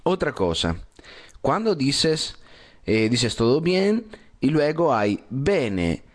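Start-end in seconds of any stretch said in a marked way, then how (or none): none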